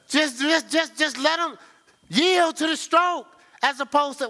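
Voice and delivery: high, loud, pleading preacher voice; rising sermon climax; preaching climax. Real, not acted. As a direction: lift it to a plea to yield.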